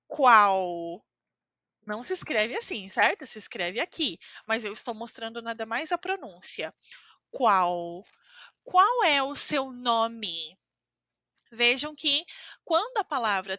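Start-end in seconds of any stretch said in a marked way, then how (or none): 0.1-1.0 "qual" ending in a U sound, said loud and drawn. Shown as a demonstration. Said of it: "a pronúncia é U". 7.3-10.6 slow, careful "Qual é o seu nome?"; slow demo. Shown as a demonstration. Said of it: "a pronúncia é U".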